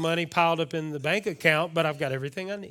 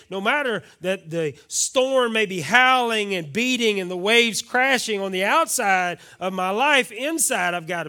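louder, building sermon cry; rising preaching; 6 semitones higher, 10 dB louder than usual. Acted. Pastor describing life's storms, preaching loudly. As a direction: let the storm roll in the voice.

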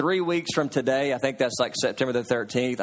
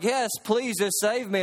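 higher-pitched mock plea; lightly acted begging to be saved; pleading; 6 semitones higher than usual. Man on the branch begs heaven, mock pleading.

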